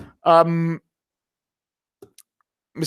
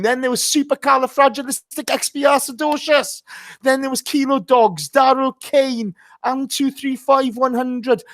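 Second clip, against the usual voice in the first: excited roll call; 10 semitones higher than usual. Real, not acted. Host reads out chat names.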